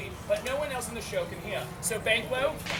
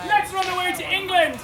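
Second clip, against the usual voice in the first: loud, high rallying call; hammed-up exclamation; 5 semitones higher, 7 dB louder than usual. King's two sons deciding to flee to England, hammed-up call. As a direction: call it out for everyone to copy.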